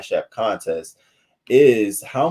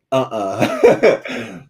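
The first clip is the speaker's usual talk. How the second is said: louder put-on dog refusal; voicing the dog; 3 semitones higher, 8 dB louder than usual. Speaking for Eli the dog refusing, louder mock refusal. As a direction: answer as the dog, a flat no.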